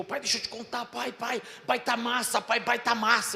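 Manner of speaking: put-on high-pitched kid bursting with news; rising to loud, high childlike excitement; excited child. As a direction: imitate a son running up breathlessly eager. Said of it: "Aí ele veio correndo".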